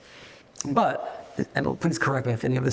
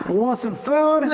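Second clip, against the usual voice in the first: high, loud, laughing "food"; hyped-up offer; 10 semitones higher, 5 dB louder than usual. Shown as a demonstration. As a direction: sell "food" with big energy. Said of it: "or should I be like".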